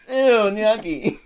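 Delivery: put-on a picky toddler pushing the plate away; disgusted toddler. Mocking gnocchi like a fussy three-year-old. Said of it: "a three-year-old that doesn't like what they're eating".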